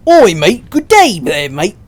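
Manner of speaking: loud, high, hammed-up Australian accent; mock Australian bloke. Streamer mocking how guys with Australian accents sound, exaggerated Aussie. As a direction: holler it like a caricature Aussie guy. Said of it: "guys with Australian accents don't sound nearly as good".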